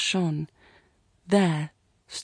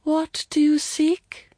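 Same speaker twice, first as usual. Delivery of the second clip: high, loud, slow call; crying voice of the shrine; 10 semitones higher, 5 dB louder, slower than usual. A narrator's reading. Unseen voice questioning the girl at the shrine, crying out. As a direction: intone it slowly, high and ringing out. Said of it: "a voice cried".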